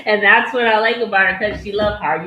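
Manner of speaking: playful mock quote of the boyfriend; mock quoting him. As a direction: echo him with a smirk. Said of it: "And he said".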